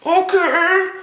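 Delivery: high, squeaky unicorn voice; tiny high-pitched creature reply. Pretend. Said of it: "That's my unicorn voice".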